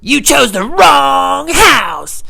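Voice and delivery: high, loud and very slow taunt; mock menacing drawl. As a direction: draw it out like an action hero. Mock threatening burglars.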